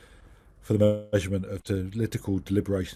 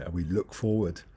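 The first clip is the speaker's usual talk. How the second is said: ordinary talk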